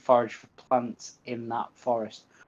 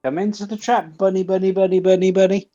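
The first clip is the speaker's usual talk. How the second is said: high, loud sing-song calling; loud, high-pitched animal call; calling a bunny; 8 semitones higher, 7 dB louder, faster than usual. Pretend. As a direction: coax the bunny in with rapid repeats. Coaxing a bunny into trap.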